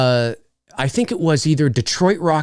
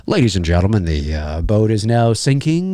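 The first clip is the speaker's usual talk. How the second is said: mock calm announcer; 6 semitones lower than usual. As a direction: announce the sinking like routine news. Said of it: "I'd be like".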